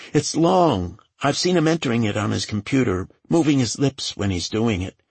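high-pitched, anxious character voice; nervous young assistant. A narrator's reading. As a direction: answer questioning in a high, hesitant voice.

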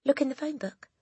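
put-on high child's voice; reply in a high, boyish voice; Eric. A narrator's reading. Boy standing up to the headmistress, high voice. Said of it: "Eric said".

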